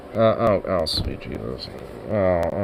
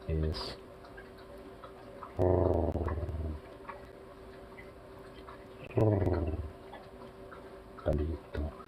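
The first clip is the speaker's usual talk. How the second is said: soft lower pet talk to Bandit; cooing at the cat; 6 semitones lower, 10 dB quieter than usual. Real, not acted. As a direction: coo tenderly to a fluffy cat. Cooing over the cat again.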